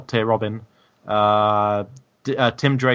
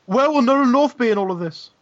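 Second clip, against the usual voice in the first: gasped, much higher, hammed-up alarm; mock alarm; 12 semitones higher, 3 dB louder than usual. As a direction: pretend shock at a sudden thought. Gasp then mock alarm.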